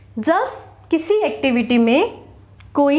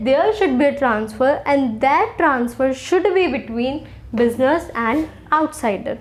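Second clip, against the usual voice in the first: slowed right down to drive home the key rule; slow emphatic point; much slower than usual. Shown as a demonstration. Teacher stressing the key rule to students, slow and deliberate. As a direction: spell out the rule slowly so it sticks. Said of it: "Very important point to be noted".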